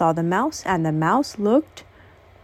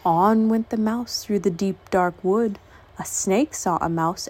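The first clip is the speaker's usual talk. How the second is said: plain reading voice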